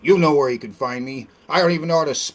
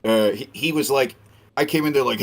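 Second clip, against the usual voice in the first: usual speaking voice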